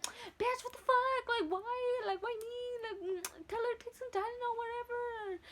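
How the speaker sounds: very high, bratty protest; mock whining. Woken sister refusing to drive, high mock whine.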